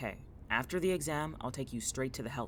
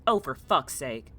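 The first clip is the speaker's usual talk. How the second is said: exasperated; 9 dB louder than usual. Acted. Interrupted by a noise downstairs.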